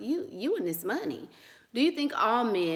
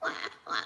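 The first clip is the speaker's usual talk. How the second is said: put-on whispered toddler wail "Wah, wah"; mock baby cry; 7 dB quieter than usual. Shown as a demonstration. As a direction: fake a baby crying, breathy. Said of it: "My baby voice is not like".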